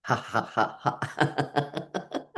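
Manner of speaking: quiet, breathy ha ha trailing off; soft ha ha laugh. Shown as a demonstration. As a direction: laugh gently under your breath. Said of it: "keep laughing".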